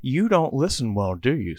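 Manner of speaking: a light take on a gruff tough-guy voice; mild tough-guy jab at the kid; Tallahassee voice. Acted. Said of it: "in that Tallahassee voice".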